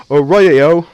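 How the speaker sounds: hammed-up bad Australian accent; failed Australian accent. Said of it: "That sounded very B-, British".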